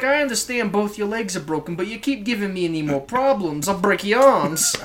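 casual New Jersey threat; New Jersey mobster. Acted. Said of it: "stereotypical, like, New Jersey's".

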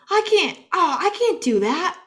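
put-on high, loud whiny little kid; high-voiced mock protest; protesting little boy. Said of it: "And, and John goes".

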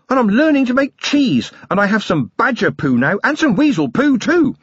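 put-on high, quick, breathless small child; a tiny boy bursting to tell his dad everything; excited little boy. Small son running to his father, bursting with excitement.

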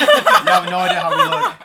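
high, loud laughing line; cracking up at the listeners. Real, not acted. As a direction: push the words out through a laugh. Laughing at the joke.

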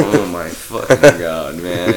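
loud, slow groan of disbelief; overwhelmed by a dumb joke; exasperated. Retold or imitated.

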